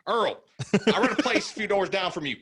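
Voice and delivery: high Southern twang; high, friendly Southern neighbor; Southern accent. Acted. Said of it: "the accent that you did".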